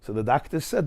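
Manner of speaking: normal talking voice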